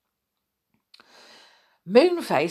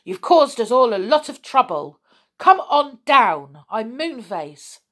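loud, raised scolding order; commanding; 5 semitones higher, 5 dB louder than usual. A narrator's reading. Moonface fetching silly Connie home, commanding. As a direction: insist firmly, higher and louder. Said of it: "commanded Moonface".

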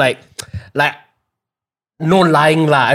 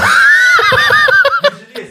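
shrieking laugh. Real, not acted. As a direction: burst into a screeching laugh. Laughs after a mock complaint.

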